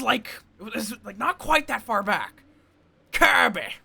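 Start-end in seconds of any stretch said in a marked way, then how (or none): 3.1-3.7 lightly voiced Kirby; a hint of a cute cartoon puffball. Shown as a demonstration. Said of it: "I also did, like, a, a Kirby".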